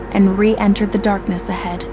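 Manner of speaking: usual speaking voice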